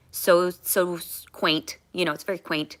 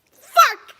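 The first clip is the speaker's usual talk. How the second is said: much higher, loud curse through tears; real outburst; 10 dB louder than usual. Real, not acted. Overwhelmed by her own story, loud tearful curse. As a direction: blurt it out, overcome.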